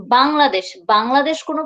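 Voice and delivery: বাংলাদেশ the local way; Bengali Bangladesh. Shown as a demonstration. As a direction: give বাংলাদেশ its home pronunciation. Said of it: "বাংলাদেশ pronunciation isn't right".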